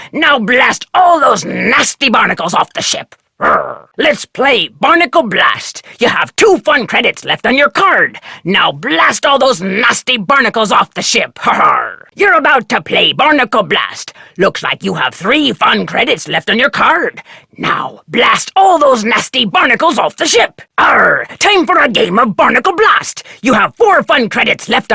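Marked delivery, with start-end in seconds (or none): 3.4-3.9 low growled rrr; pirate growl. Acted. Arcade pirate growling at the player, low rrr. 20.8-21.3 growled pirate arr. Acted. Arcade pirate calling out to player, growled arr.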